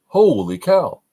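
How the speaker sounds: high, loud holy cow; genuinely startled by the move; surprised exclamation. Real, not acted. Teacher startled by the opponent's move, high loud gasp.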